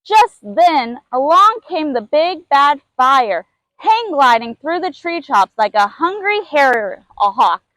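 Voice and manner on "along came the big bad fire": plain reading voice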